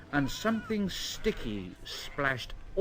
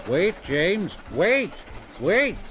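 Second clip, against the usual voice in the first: louder, higher urgent calls; calling out; 4 semitones higher, 4 dB louder, slower than usual. A narrator's reading. The driver calls to James, loud urgent warning. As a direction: call him to stop, rising urgency. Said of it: "His driver called to him".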